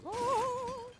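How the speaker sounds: very high sudden "Oh!"; Jesus waking in the silly video; revived gasp. Retold or imitated. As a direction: pop up with a startled cry. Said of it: "it goes".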